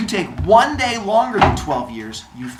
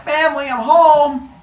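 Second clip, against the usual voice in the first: higher, louder cheery hero voice calling in; calling out home; 11 semitones higher, 6 dB louder than usual. Acted. Heracles comes home to his family: high cheery call.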